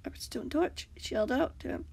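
usual speaking voice

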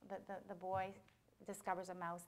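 plain reading voice

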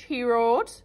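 ordinary delivery

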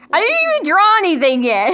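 high, loud, indignant outburst; exasperated protest. Real, not acted. Artist to guessers jumping ahead, exasperated protest. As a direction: protest loudly with your pitch shooting up.

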